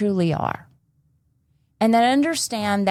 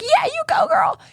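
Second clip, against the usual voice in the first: very high cheering squeal; 3 dB louder than usual. Retold or imitated. Cheering the cursing girl in the video, high and delighted. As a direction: cheer her on like a proud fan. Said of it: "and I'm like".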